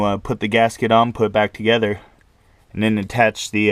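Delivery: usual speaking voice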